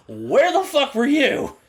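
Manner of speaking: hammed-up outraged demand; mock indignation. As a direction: drag it out in disbelief at him. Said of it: "I was like".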